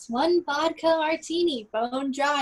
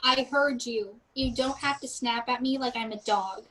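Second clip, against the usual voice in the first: mildly annoyed waitress. Acted. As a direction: let a touch of irritation show. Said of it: "obviously annoyed".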